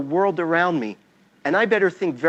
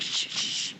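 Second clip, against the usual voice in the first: soft whispered shush; hushing whisper; 11 dB quieter than usual. Retold or imitated. Boy shushing his sister as Mom watches, whispered. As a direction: hush her quickly under your breath. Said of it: "I'm like".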